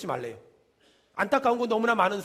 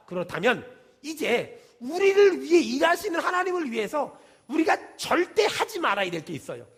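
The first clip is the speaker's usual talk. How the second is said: ordinary talk, nothing marked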